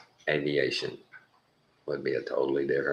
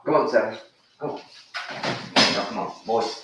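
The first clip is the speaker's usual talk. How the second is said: calling the dog; 7 semitones higher, faster than usual. Real, not acted. Calling his dog Seven.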